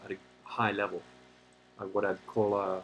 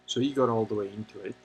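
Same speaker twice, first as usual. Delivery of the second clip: usual speaking voice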